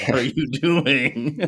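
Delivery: slow, laughing through the words; laughing question. Retold or imitated. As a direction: ask it while cracking up at her stare. Daughter stares at him oddly.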